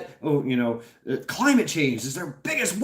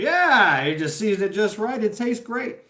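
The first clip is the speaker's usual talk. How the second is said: happy cannibal; 7 semitones higher than usual. Retold or imitated. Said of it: "they're like".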